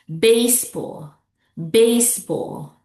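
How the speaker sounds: BASEBALL slowly, BASE stressed; first-syllable stress. Shown as a demonstration. Teacher models the second example, stress on BASE. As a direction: draw out and punch BASE in BASEBALL. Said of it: "the second example here is baseball".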